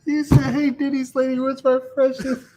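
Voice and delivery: high, laughing mock quote; laughing mockery. Real, not acted. Co-host laughing while mocking the Denny's line. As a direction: crack up while quoting him.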